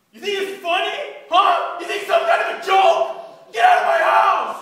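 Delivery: loud, high-pitched raging rant; furious shouting. Acted. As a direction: build to an all-out bellow. Acted furious man confronting intruder.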